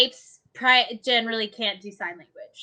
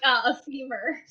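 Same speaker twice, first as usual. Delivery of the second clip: ordinary delivery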